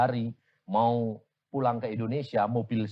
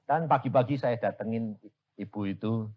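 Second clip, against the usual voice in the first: ordinary talk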